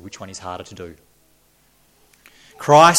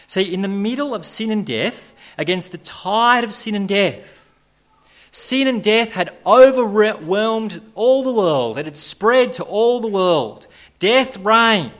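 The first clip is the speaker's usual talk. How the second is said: higher and louder, driving each phrase home; preaching at full force; 10 semitones higher, 6 dB louder, slower than usual. Acted. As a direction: build the sermon to a thundering peak. Preacher builds to a climax.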